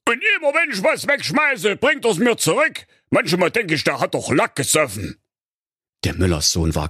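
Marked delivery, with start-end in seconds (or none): 0.1-5.2 put-on a puzzled old king raising a complaint. A narrator's reading. King complains about the dog.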